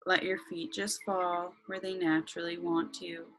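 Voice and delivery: slow, calm meditation voice; guided meditation. Acted.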